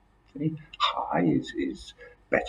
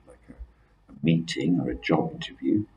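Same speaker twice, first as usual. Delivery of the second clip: normal talking voice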